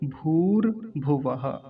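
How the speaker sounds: slow "भूर्भुवः" as bhoor, bhu-va-ha; deliberate model "भूर्भुवः"; careful pronunciation. Shown as a demonstration. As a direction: separate the parts, sound the final ha. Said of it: "अगला शब्द है".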